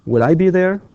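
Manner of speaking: will I be there with the pitch rising high at the end; teacher's rising question; question rise. Shown as a demonstration. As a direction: ask it with a clear rising question. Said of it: "The question will be".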